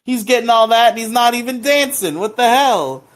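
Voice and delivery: high, loud mock-jealous whine; a high, loud teasing complaint about his friend; playful jealousy. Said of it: "like, some playful jealousy".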